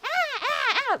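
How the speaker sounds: high, thin mock squawks; imitated chicken screech. Streamer imitating the screaming Heihei toy, mock squawks. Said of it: "I can't make a hey, hey screaming noise".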